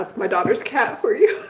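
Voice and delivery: usual speaking voice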